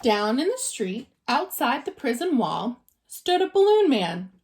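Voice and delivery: usual speaking voice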